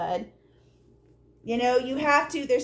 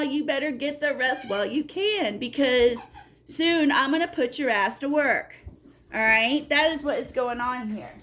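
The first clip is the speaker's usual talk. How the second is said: ordinary talk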